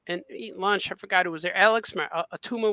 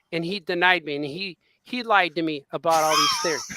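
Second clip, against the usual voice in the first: ordinary delivery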